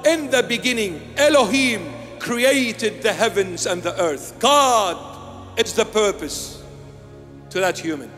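usual speaking voice

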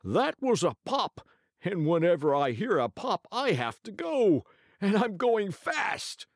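very high panicky weasel; sly hissing weasel. Acted. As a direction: squeak it out in fright. Weasel voice in story.

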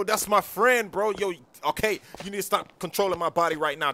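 ordinary delivery

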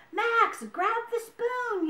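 high, slow, urgent yell. A narrator's reading. Dakota to Max in the soup, yelling. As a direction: shout an urgent instruction, stretched out high. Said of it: "yelled Dakota".

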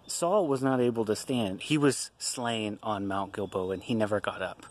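usual speaking voice

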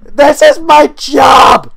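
high, loud and slow; slow loud despair; despairing shout. Real, not acted. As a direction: bellow it to the empty house. Yelling at the situation.

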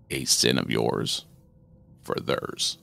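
low, slow gleeful rasp; giddy demon. Acted. Demon offering the mother a deal, gleeful low rasp. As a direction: relish the offer. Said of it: "His voice was almost giddy".

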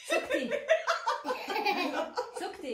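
laughing burst. Real, not acted. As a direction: giggle through it. Laughing at guesser.

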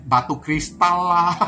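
drawn-out words tipping into a laugh; amused, breaking into laughter. Real, not acted. Tarot reader joking about buying crystals, amused and laughing. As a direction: let the last word dissolve into a chuckle. Laughs while listing odd purchases.

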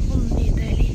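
loud burst of delight; delighted gasp. Real, not acted. Seeing the lovely water, a loud delighted exclamation. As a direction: exclaim it loudly, thrilled.

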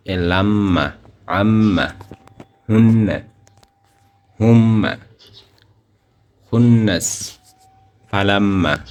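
লাম্মা with the ম্ম hummed through the nose; ghunna recitation. Shown as a demonstration. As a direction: recite each, humming the doubled letter. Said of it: "আরো অনেকগুলো উদাহরণ দিয়েছে".

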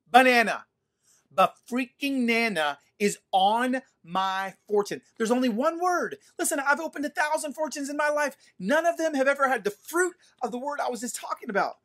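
high, worked-up retold rant; freaking out. Preacher re-enacting his fortune cookie moment, freaking out. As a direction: rant in amazed disbelief, punching the first word loud. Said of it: "And I'm freaking out".

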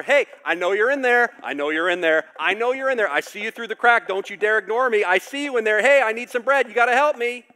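loud, high, insistent calling through a door; demanding shout at a locked door; pounding on the door. Retold or imitated. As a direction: yell at the neighbour to open up. Friend banging on door at night.

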